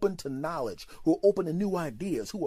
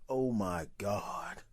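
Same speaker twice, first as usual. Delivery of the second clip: horrified gasp; 6 semitones lower, 5 dB quieter, much slower than usual. Retold or imitated. Seeing the filthy truck.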